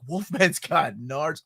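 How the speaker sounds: slow, measured mock amazement; amazed discovery. Kid finds Wolfman does have them, slow mock amazement. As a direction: imitate a kid's stunned realisation, drawn out slowly. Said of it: "I love that line, the delivery".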